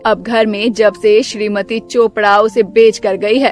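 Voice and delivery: low, bossy mother-in-law voice, stretched call; stern mother-in-law. Acted. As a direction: play the grumpy saas calling her daughter-in-law.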